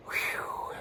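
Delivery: breathy whispered exhale; whispered whew. Real, not acted. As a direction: let out a soft whew.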